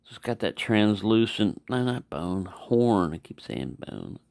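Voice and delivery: ordinary delivery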